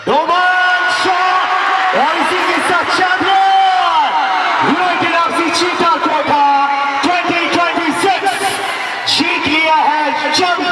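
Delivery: high, drawn-out commentator's cry of victory; real climactic stadium shout, pitched high; triumphant winning call. Real, not acted. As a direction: stretch the names out and proclaim the champions. Calls the championship-winning shot.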